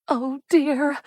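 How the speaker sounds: put-on high alarmed older lady; high fretful gasp. A narrator's reading. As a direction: breathe it out in shock. Cordelia alarmed.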